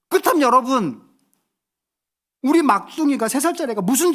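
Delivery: high, forceful sermon voice; impassioned preaching. Acted. Preacher moved by his toddler's confession, impassioned appeal. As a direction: drive the point home to the congregation with rising fervour. Preacher drives home the point.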